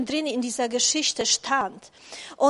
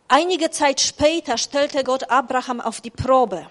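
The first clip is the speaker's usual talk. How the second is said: scripture reading; 5 dB louder than usual. Acted. Reads Genesis 22 aloud.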